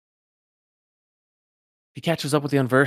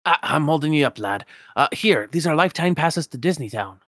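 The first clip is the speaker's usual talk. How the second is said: higher, louder Scottish miser voice; indignant old Scotsman, raised and rolling; Scrooge McDuck; 4 semitones higher, 6 dB louder than usual. Acted.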